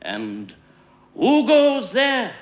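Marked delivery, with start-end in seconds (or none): 1.2-2.3 high, loud, slow challenge; calling out. A narrator's reading. Will Sentry challenges a flying duck, calling out. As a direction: call it out like a sentry. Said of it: "called Will Sentry to a wild duck flying".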